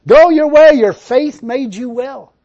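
loud, slow proclamation; proclaiming. Retold or imitated. Preacher proclaims Jesus' words to the congregation, loud and slow. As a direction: ring it out slowly.

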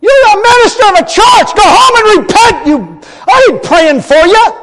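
loud, high, furious scolding; indignant rebuke. Retold or imitated. Preacher silently scolds the backslidden minister, loud and furious. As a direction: tear into him like a prophet.

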